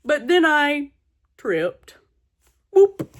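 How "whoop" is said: sudden high loud whoop; high yelp. Acted. Gerald trips while running to Piggy, high loud whoop. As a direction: yelp as you trip.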